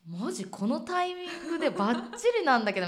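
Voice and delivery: high, loud delighted outburst; thrilled, laughing at the lucky timing; excited laugh. Real, not acted. (興奮して笑いながら)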